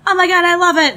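high, loud mock gushing; extreme high. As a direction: gush it at the top of your range. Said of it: "extreme highs, extreme lows".

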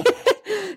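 high burst of laughter; real laugh. Real, not acted. Mother laughing about the dreaded little room, high laugh.

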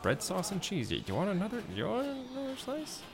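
high, lilting mom voice; hesitant southern mom. Acted. Mom offering a hungry guest another slice, hesitant. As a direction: pitch climbing into a tentative southern-mom offer. Game master plays Jimmy's mom.

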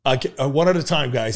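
normal talking voice

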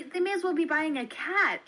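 disbelieving outburst; horrified disbelief at selling babies; incredulous. Real, not acted. Outraged at baby sale.

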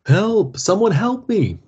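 high, loud desperate call; drowning man shouting to the shore; shouting for help. A narrator's reading. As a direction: yell for anyone to hear.

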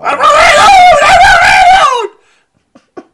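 very high, loud, stretched-out shriek; mock scream. Imagining the hamster scene, shrieked safe word in mock panic.

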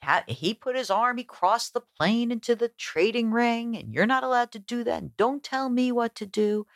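a half-hearted imitation of a trader yelling in the ring; retold yell. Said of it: "one guy's yelling".

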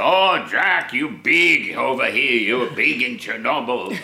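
Ukrainian fan greeting Jack, a hint of Ukrainian accent. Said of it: "That's the Ukrainian accent, was it?".

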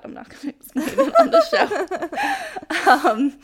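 laughing. Real, not acted. Laughing while quoting the host.

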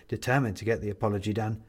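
ordinary delivery

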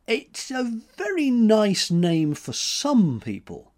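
high, slow careful reply; guarded. A narrator's reading. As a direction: choose each word with care. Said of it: "he said guardedly".

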